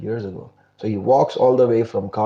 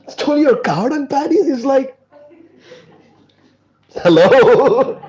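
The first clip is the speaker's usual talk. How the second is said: high, loud mocking disbelief, laughing through it; can you believe this; incredulous; 13 semitones higher, 6 dB louder than usual. [name] hearing about stolen cow dung paddies, incredulous.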